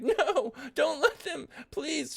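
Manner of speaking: very high acted wail; crying plea. Captive begging friends as guards drag her away, crying. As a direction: plead in a high, crying squeal. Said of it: "crying, like".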